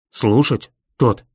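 low, punchy dog voice; blurting dog. A narrator's reading. Dog breaking in on his master, low blurted words. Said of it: "бухнул Мэнчи".

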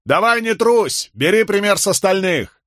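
high-pitched heckle from the crowd; jeering shout. A narrator's reading. Joker goading the frightened condemned man, jeering shout.

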